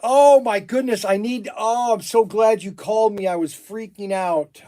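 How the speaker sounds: high, loud, rushing relief; relieved gush. Real, not acted. Shop owner greeting the caller, loud relieved gush. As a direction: pour out your relief. Relieved call from the power company.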